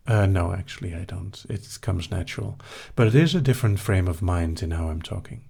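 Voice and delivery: ordinary delivery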